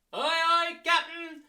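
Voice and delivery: high, loud sailor's salute. Shown as a demonstration. Teacher playing a sailor answering the captain, high and loud. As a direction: shout it like an eager deckhand. Crew reply in the game.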